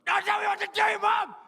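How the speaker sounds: high, screamed tantrum; aggressive shout. Acted. Grown son told what to do by mum, screaming tantrum. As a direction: scream it in furious protest. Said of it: "quite an aggressive way to end".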